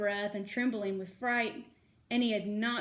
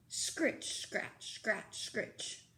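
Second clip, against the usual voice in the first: whispered scratching sounds; 7 dB quieter, much slower than usual. A narrator's reading. Noise of a shovel.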